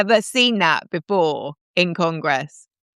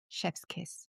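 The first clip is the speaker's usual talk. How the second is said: breathy, much softer hushed aside; whispered chef's kiss; 20 dB quieter than usual. Real, not acted. Host savoring the senator's takedown, a soft breathy whisper. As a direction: whisper it like savoring something perfect.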